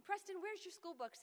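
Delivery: busy mom's bossy call; barking orders. Retold or imitated. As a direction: snap out chores at the kids. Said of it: "You're barking out orders all day".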